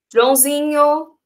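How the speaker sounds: calling out. Shown as a demonstration. Teacher calls quiet back-row pupil.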